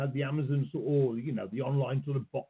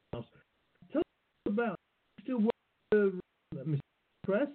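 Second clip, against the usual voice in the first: choppy, breaking-up call audio; words dropping out on a bad line; over the phone; 6 semitones higher, faster than usual. Real, not acted. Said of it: "you're breaking up for me a little bit".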